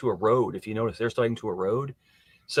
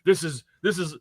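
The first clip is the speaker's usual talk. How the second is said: mock crying; 7 semitones higher than usual. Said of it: "he was, like, crying".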